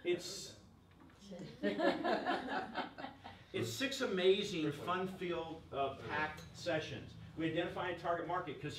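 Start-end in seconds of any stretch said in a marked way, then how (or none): 1.0-3.5 short laugh. Real, not acted. Laughs at Danielle's interruption.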